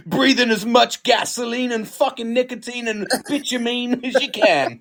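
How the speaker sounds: mock ad. Mock cigarette advert voice.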